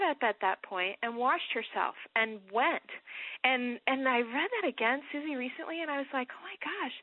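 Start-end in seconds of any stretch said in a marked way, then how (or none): 6.3-6.9 surprised gasp. Retold or imitated. Sudden realization rereading Hannah.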